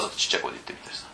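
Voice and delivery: quick, soft whisper; deliberately tiny voice. Shown as a demonstration. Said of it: "わざとちっちゃい声で". (わざと小さな声で早口に)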